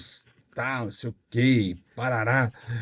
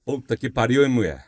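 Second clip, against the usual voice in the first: normal talking voice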